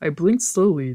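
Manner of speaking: usual speaking voice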